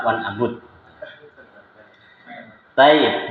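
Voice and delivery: ordinary talk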